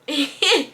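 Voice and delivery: quick burst of laughter; short real laugh. Real, not acted. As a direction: laugh at the thought. Laughs at her own joke.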